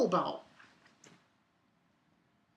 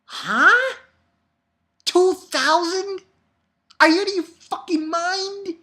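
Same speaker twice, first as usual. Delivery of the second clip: higher, louder disbelief shout; shocked outburst; 12 semitones higher, 8 dB louder than usual. Real, not acted. Reader shouting at the manga, shocked. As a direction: yell at the page in disbelief.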